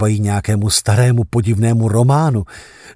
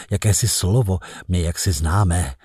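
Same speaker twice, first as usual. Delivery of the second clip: usual speaking voice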